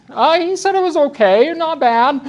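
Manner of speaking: high, mock-innocent relay; passing Mike's answer back to the staff member, mock-innocent; sarcastic report. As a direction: play it innocent but sarcastic. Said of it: "I'm being sarcastic".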